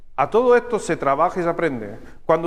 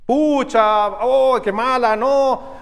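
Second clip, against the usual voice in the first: mock dismay; 4 semitones higher, much slower than usual. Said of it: "hay una reacción emocional".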